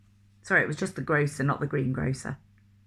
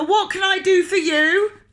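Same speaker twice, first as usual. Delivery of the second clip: bellow; 13 semitones higher, 8 dB louder than usual. Acted. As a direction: boom it across the shop. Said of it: "bellowed the grocer".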